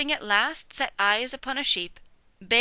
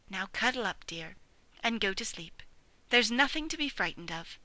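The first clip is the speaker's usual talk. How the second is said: soothing mother; 5 dB quieter than usual. Acted. Mother dog comforting scared piglet, soft soothing voice. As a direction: hush him gently back to calm.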